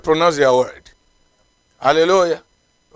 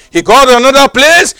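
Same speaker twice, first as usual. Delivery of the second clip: ordinary talk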